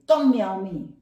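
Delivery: loud irritated scolding; upset student scolding the kitty, loud and irritated; annoyed snap. Pretend. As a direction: snap at the cat. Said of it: "today you are not happy".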